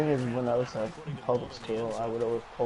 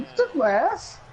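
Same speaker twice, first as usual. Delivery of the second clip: mock outraged quote; 14 semitones higher, 4 dB louder, slower than usual. Mock reaction to being touched.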